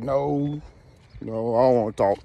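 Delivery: deep timid mumble; scared party guest refusing to talk; low mumbling refusal. Acted. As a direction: drop way low, reluctant and shy.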